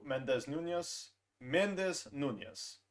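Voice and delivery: "Mendez Nunez" said slowly, Spanish "Nunez" as nunjes; careful pronunciation. Shown as a demonstration. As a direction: spell out "Mendez Nunez" deliberately, syllable by syllable. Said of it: "It's pronounced Mendez Nunez".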